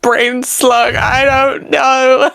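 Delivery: high, slow, pleading apology; tearful apology. Acted. Lyra apologizes to Jericho for forgetting him, upset and pleading. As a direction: wail it out, drawing out each sorry. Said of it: "She'd be like".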